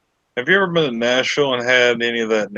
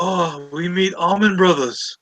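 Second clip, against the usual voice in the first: mock tourist voice; 7 semitones higher than usual. Mocking tourists' broken English.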